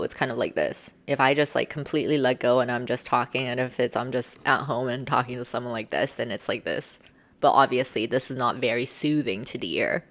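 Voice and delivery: low, casual, at-home voice; low natural pitch. Shown as a demonstration. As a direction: drop into a low, relaxed register. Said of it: "for me, it's pretty low".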